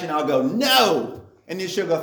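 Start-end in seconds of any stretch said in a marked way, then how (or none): 0.4-1.2 flat mock refusal, a little higher and louder; sarcastic no. Husband teasing wife with a mock refusal, sarcastic. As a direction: say no as a joke you don't mean. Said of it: "always so sarcastic at home".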